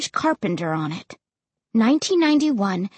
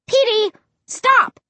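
high, loud shout after a dog; loud, exasperated calling; exasperated yell; 12 semitones higher, 5 dB louder than usual. A narrator's reading. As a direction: yell in frustration at the runaway dog. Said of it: "I yelled, exasperated".

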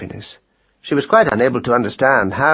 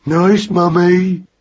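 put-on higher, louder, slow baby bellow; warning cry; 7 semitones higher, 5 dB louder, much slower than usual. A narrator's reading. Magnus crying to his mother, loud and slow. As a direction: boom it out like a giant infant. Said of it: "the warning cry of".

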